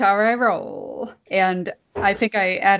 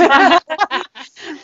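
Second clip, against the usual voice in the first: big scornful laugh; mocking laugh; 7 semitones higher, 5 dB louder than usual. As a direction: laugh at her like a husband. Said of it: "laughed his ass off".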